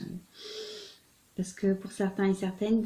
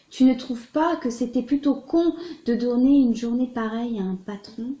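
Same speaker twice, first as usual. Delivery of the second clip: louder, deliberate poem reading; poetry recitation; 2 semitones higher, 6 dB louder than usual. Acted. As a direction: read the poem out, clearly projected. Récite un poème de Prévert. (récitation de poésie, voix posée et projetée)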